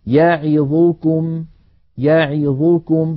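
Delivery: يعظكم drawn out slowly with every vowel given the same length; slow recited example. Shown as a demonstration. Teacher recites a Quran example, slow and even. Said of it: "الأمثلة".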